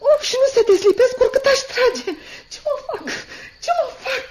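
high-pitched helpless wail, kept down; panicked distress. Acted. Girl stuck to the goose feather, high panicked fretting. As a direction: fret in a high voice, trying to pull free.

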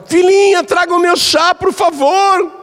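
put-on very high, polite little old woman; sweet old mother-in-law. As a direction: coo the request like a doting granny. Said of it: "E a sogra". (velhinha doce, voz bem aguda)

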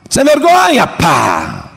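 high shriek of an angry father, then a slap sound; mock angry father. Father yelling at his pregnant daughter, shrieking high. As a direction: scold hard then smack.

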